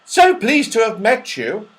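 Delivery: very high, loud rabbit voice. Acted. Rabbits taking leave of the children, very high and loud. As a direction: squeak out a polite goodbye. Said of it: "they said".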